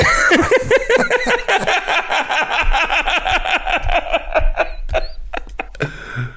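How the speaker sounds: long high laugh at the memory. Real, not acted. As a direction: crack up at your own story. Laughing at the retold outburst.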